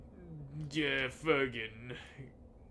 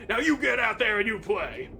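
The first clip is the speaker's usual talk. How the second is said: coach shout; 7 semitones higher, 8 dB louder than usual. Acted. Coach sends the mower out.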